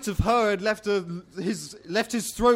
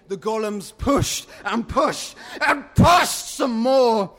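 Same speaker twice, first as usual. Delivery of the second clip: each push harder and louder, building; straining effort; 4 semitones higher, 4 dB louder than usual. A narrator's reading. Narrator telling of golems forcing the doors, straining. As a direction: heave the words out as if shoving.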